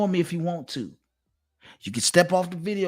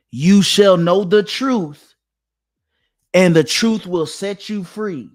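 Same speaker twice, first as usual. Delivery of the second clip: loud, slow scripture proclamation; preaching; 2 semitones higher, 7 dB louder, slower than usual. Acted. Quoting scripture to sister.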